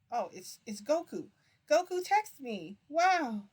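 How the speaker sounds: slow, loud, high-pitched exclaiming; loud excitement. Real, not acted. Streamer reacting to a text from Goku, loud excitement. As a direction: build to a big, high, drawn-out wow.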